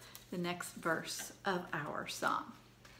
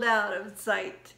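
storytime recitation; 5 dB louder, slower than usual. Acted. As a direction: lively rhyme read to kids. Second recital of rhyme.

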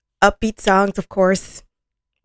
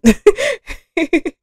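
bright laugh; 11 semitones higher, 5 dB louder than usual. Real, not acted. Laughing after question.

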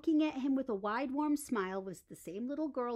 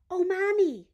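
put-on high, bright child's voice, a bit louder; excited little girl; 9 semitones higher, 8 dB louder than usual. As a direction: call to mom like an eager child. Little girl spots the bear.

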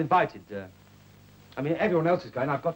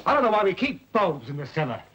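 raised voice; 4 semitones higher than usual. Acted. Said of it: "heard you raise your voice to me".

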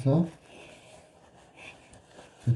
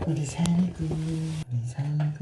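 cooing to a pet; 5 semitones higher, 4 dB quieter, much slower than usual. Real, not acted. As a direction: soothe the puppy tenderly. Owner soothing the pet.

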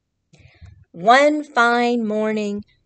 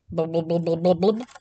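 fish bubbling; 5 semitones lower than usual. Acted.

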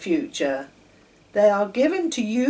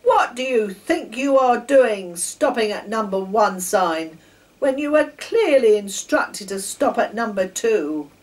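loud, higher stern official; louder, scolding station master; 4 semitones higher, 9 dB louder than usual. A narrator's reading. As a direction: demand an answer angrily.

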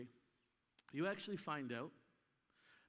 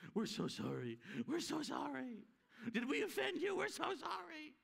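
high, whiny mock apology; mock a spineless church grovelling; spineless apologizer; 9 semitones higher than usual. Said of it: "the church of the jellyfish".

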